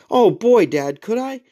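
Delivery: high, gushing kid voice, hammed up; mock eagerness. Son pretending delight at a chore, mock eager. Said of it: "No, he didn't say that".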